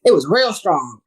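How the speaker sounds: normal talking voice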